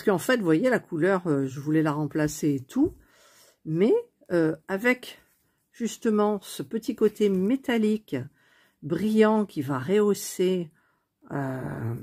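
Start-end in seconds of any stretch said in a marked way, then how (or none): none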